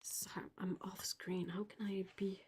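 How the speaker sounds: hushed whisper while off screen; whispered aside. Real, not acted. Streamer whispers an aside while reaching off camera, hushed. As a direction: whisper it under your breath as you reach away.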